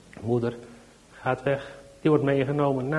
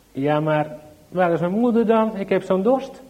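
put-on anxious little child; 6 semitones higher, 6 dB louder than usual. Child keeps asking for mother.

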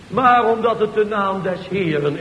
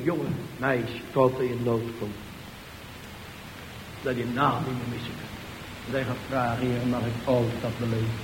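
hushed; 10 semitones lower, 11 dB quieter than usual. Acted. Preacher turns hushed and intimate.

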